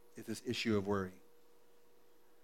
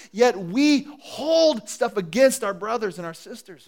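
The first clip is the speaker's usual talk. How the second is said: higher, louder, slow emphatic preaching; passionate preaching; 9 semitones higher, 9 dB louder, slower than usual. Acted. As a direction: plead with the congregation, slowly. Passionate sermon climax.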